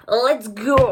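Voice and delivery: put-on a loud, broken English accent. Vloggeuse qui lance son tuto avec un accent anglais cassé. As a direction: throw in a mock English accent. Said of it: "Pourquoi je prends un accent anglais".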